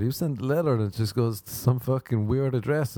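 ordinary talk, nothing marked

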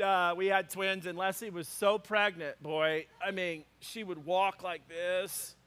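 ordinary talk

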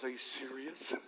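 put-on small hushed disbelieving child; stunned child voice. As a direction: imitate a little girl's quiet disbelief. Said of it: "looks at me and goes".

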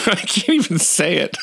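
high, helpless real laughter; breaking up laughing. Real, not acted. Dad cracking up at his son's words, laughing. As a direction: try to speak through real giggles.

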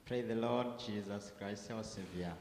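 normal talking voice

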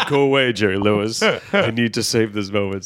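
laughing, pleading look put in words; laughing plea. Retold or imitated. Comic telling Jerry Lewis to leave, laughing plea. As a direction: laugh through the go away. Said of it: "look at my face is".

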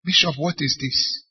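ordinary talk, nothing marked

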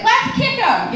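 high, loud crowd heckle; rally the mob in mock outrage; mock mob cry. Acts out the clapping crowd.

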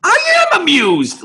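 very high, hammed-up cartoon holler; weird Mel Blanc yell. Bugs at the amusement park, sudden weird high yell. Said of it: "that weird Mel Blanc yell".

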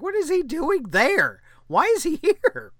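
high, baffled mock disbelief; puzzled at seeing him there; incredulous. Podcaster recalling seeing George Lucas, baffled and high. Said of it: "I was like".

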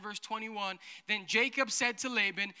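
ordinary talk, nothing marked

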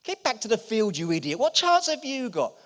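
incredulous sneering yell; scornful brothers. Retold or imitated. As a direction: jeer at the little brother. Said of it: "His brothers".

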